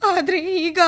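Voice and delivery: put-on an old mother starting to cry over her son; tearful, words breaking off. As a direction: voice breaking into tears as she speaks. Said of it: "ಅಳಬೇಡಿ ತಾಯಿ".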